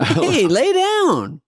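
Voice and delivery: very high, very slow mock-soothing dad. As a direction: drag it out like a sarcastic father. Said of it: "he never would give it to me".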